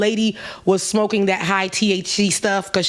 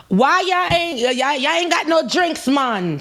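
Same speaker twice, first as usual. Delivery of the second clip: high mocking sassy voice; mock the tourists with a high brash voice; 6 semitones higher than usual. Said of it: "she's mocking them".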